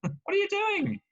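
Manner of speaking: a cartoon donkey yelling at its master; talking donkey. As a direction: play the fed-up donkey telling off its rider. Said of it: "the donkey turns around and says".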